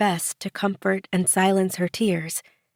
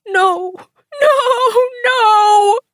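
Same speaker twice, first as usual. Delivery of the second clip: shrieking, much higher and louder, slow; desperate holler; 6 dB louder, much slower than usual. A narrator's reading. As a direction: scream it, clinging on for dear life. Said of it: "Bishop hollered".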